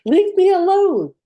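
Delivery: high, loud scream of protest; child's scream. Acted. Little girl fleeing upstairs from a man, acted scream. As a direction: cry out as a little girl being chased.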